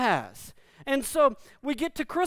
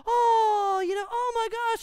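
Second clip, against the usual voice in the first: high, excited family shriek imitated; excited squeal; 12 semitones higher than usual. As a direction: mimic the family's delighted squealing at the puppy. Family sees the puppy.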